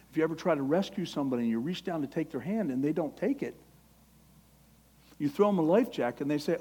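usual speaking voice

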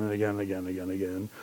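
rapid tailing off. Acted.

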